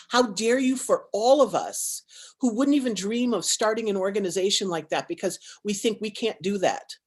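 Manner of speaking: normal talking voice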